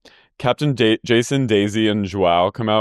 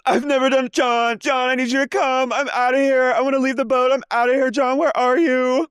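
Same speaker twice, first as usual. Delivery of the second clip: much higher whiny plea; mock Lisa calling John. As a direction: whine for Daddy John. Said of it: "She goes".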